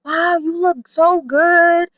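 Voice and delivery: high-pitched exaggerated praise; gushing family. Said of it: "it'd be like".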